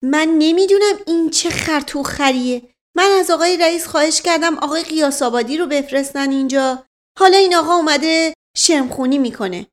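high, fast angry scolding; indignant lady. Acted. Lady of the house scolding the officer, furious. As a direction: berate the officer. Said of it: "عزیزالسلطنه فریاد زد".

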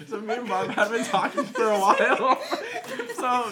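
laughing through the words; can't stop laughing. Real, not acted. Retelling while laughing.

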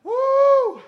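a sudden high excited whoop; preacher's whoop. Real, not acted. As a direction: let out a joyful whoop. Preacher whoops mid-sermon.

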